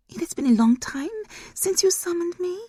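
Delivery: high, otherworldly reproach; high eerie demon voice; demon mother's voice. Acted. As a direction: chide her like a demon queen.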